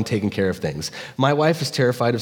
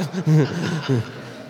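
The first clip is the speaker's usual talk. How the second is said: short laugh; 4 semitones higher than usual. Real, not acted. Laughs at own bit.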